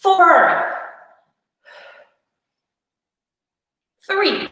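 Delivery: breathless from the exercise; out of breath. Real, not acted. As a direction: count while short of breath. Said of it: "My breath".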